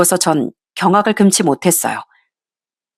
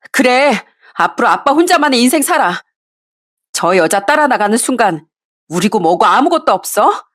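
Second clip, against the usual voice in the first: angry son; 3 semitones higher than usual. Acted. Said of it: "화를 내면서 소리를 쳤습니다".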